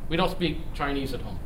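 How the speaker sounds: normal talking voice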